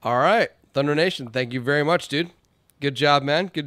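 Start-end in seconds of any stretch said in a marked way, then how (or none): none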